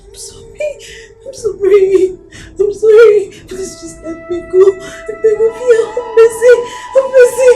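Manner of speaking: high-pitched tearful begging; tearful pleading. Acted. Captive begging the kidnapper to let go, tearful high plea. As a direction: beg for mercy through tears.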